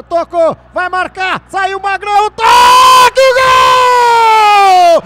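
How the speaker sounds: explosive goal commentary, building to a huge shout; goal scream. Real, not acted. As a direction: erupt into a full-throated goal call. Goal scored, narrator erupts.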